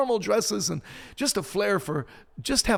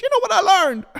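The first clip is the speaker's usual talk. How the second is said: very high, loud squeal of a question; high-pitched voice; 9 dB louder than usual. Shown as a demonstration. Preacher replaying his own outburst to listeners, high-pitched. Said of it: "I just went into my high-pitched voice".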